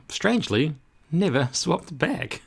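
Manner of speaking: plain reading voice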